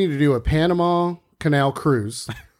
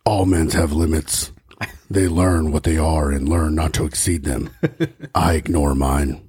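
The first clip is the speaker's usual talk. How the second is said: low brooding Batman voice; Batman impression; 11 semitones lower than usual. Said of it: "I am the Batman".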